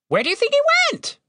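very high, quick bird voice; high squawky question; talking raven. A narrator's reading. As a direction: ask it fast as a high-pitched raven.

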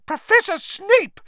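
very high, loud, scandalised shriek. A narrator's reading. Matron rebuking a raging professor, shrieking. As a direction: shriek the name in outrage. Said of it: "shrieked Madame Pumphrey".

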